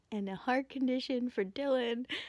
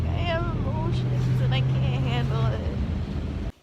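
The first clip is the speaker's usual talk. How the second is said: overwhelmed; 2 semitones higher, 9 dB louder, slower than usual. Retold or imitated. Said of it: "I'm on the bus and I'm like".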